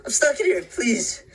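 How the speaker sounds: slow, high, loud plea for help; desperate shout. Acted. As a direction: shout yourself raw begging. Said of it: "I shout till my throat is raw".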